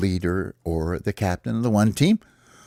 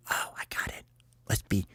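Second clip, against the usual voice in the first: excited idea in a whisper; soft huddle whisper; 2 semitones lower, 11 dB quieter than usual. Acted. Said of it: "they go over and they whisper".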